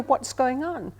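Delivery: usual speaking voice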